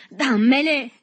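high, loud demand; repeated shout. A narrator's reading. Berto rolls in sand demanding cigarettes, shouting. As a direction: yell it from the ground. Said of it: "gridò ancora Berto".

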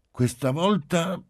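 measured, high-pitched pronouncement; judicious little girl. A narrator's reading. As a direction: weigh the words like a sensible child. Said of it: "ella disse giudiziosamente".

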